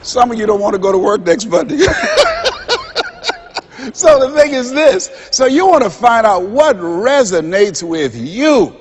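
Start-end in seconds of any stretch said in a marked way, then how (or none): 1.9-3.9 high, delighted laughter; real hearty laugh; big laugh. Real, not acted. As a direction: laugh with the audience. Laughs after Monday joke.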